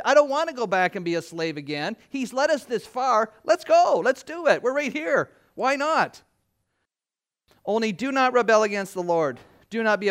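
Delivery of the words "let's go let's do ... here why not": higher, louder rallying cry; hammed-up excited rally; eager call. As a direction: rouse the crowd forward. Eager group urging forward.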